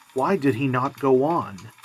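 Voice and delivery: ordinary delivery, nothing marked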